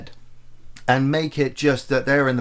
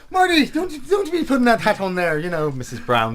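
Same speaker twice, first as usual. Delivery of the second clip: high, hammed-up Irish mum; mock the Irish mum voice; bad Irish accent; 7 semitones higher than usual. Imitating the mum scolding Marty, hammed Irish. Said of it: "that was what that".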